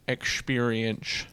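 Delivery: drawn-out, quiet repeat of Experience; slow soft drawl. Shown as a demonstration. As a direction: stretch out Experience softly and slowly.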